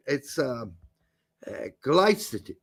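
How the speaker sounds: usual speaking voice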